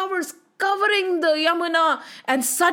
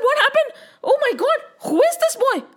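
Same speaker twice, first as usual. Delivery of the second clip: high-pitched, bewildered exclamations; stunned serpent; 11 semitones higher than usual. Acted. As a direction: voice a dazed villain who can't believe it. Dazed serpent reacts to being thrown.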